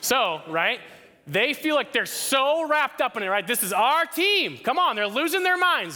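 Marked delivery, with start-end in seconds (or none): 1.3-6.0 much higher, louder mock fan hype; fan excitement. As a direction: whip it up like a fan in the stands. Imitating fans cheering at a game.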